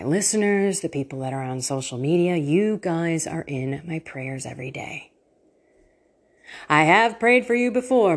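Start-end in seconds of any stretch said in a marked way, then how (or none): none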